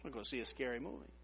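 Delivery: low, soft, faux-macho voice; mock tough guy. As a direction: puff up like you're not scared. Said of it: "and I said".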